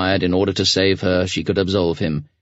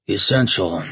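thoughtful; 2 semitones higher than usual. A narrator's reading. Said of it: "the commander repeated thoughtfully".

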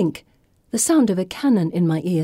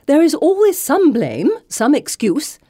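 higher, louder, haughty dismissal; sniffy disdain; 9 semitones higher, 5 dB louder than usual. Acted. Headmistress dismisses the foreman's excuses, haughty sniff. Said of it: "Missus Nightwing sniffs".

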